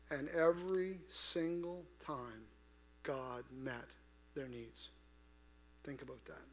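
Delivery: quietly weighty, pausing between phrases; hushed preaching. Real, not acted. Preacher asks congregation to reflect on God's provision, hushed.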